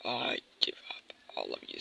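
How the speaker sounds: soft whispered surrender; defeated whisper. Pretend. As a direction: whisper it as you give up.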